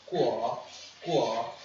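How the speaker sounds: "Guo" climbing from a low start; rising second tone. Shown as a demonstration. Teacher proving the tone goes up, "guo" rising each time. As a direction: slide each "guo" upward. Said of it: "está subindo".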